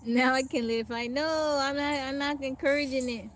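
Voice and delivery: loud, high, laughing burst; blurting it out through a laugh; laughing outburst. Real, not acted. Reacting to viewers cursing in chat, loud laughing outburst.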